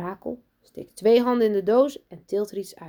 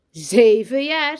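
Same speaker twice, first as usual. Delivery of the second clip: loud, slow, drawn-out repeat; emphatic echo; 6 semitones higher, 11 dB louder, much slower than usual. A narrator's reading. Teacher shows off new boy's age, loudly emphatic. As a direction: stretch it out loudly for everyone to marvel at.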